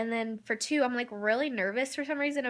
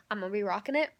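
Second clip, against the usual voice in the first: ordinary talk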